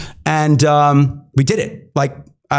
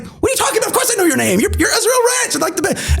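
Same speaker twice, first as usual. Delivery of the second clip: mock-amazed Pat; faster than usual. Pat's reply, voiced high.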